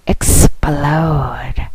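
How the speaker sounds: breathy final whisper. Acted. Whispered seductive sign-off.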